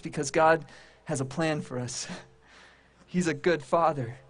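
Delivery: usual speaking voice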